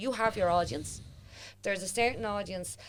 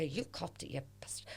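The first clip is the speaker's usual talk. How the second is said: hushed, muttered jibe; under the breath, teasing; a whispered mock insult; 3 semitones lower, 12 dB quieter than usual. Mock accusation whispered to friend.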